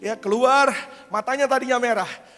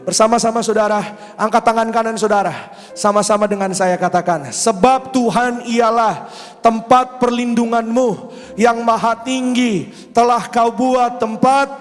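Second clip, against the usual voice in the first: preaching at full force; 7 dB louder than usual. Real, not acted. Pastor has the church stand and read Psalm 91 aloud. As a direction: lead the church through the psalm. Leads declaration and scripture.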